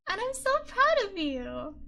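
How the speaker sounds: high, loud and slow praise; proud gushing. Real, not acted. Streamer praising viewers, excited and slow. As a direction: lean into the pride. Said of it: "I get really excited".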